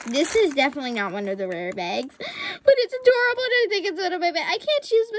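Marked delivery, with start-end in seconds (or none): 2.2-5.1 high, fast gushing delight; excited gushing. Real, not acted. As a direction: rave about a favorite. Gushes over a favorite.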